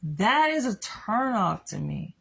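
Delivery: ordinary talk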